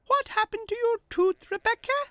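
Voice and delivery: a cheerful tiny tractor asking kindly; little tractor Tech. A narrator's reading. Tech asks poorly horse Henry, high little-tractor voice.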